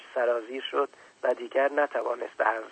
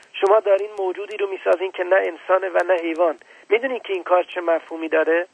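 put-on higher, louder angry child; trembling with anger; 6 semitones higher, 4 dB louder than usual. Little prince accusing the scientist, higher angry child voice. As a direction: accuse the grown-ups hotly.

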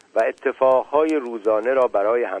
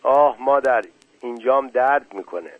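ordinary delivery

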